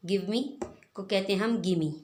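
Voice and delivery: normal talking voice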